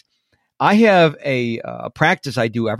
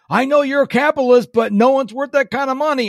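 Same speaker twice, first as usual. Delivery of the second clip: higher, faster mock-indignant dad; imitating dad; 10 semitones higher, faster than usual. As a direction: play the fuming old father, pitched up and quick. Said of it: "my dad will call me".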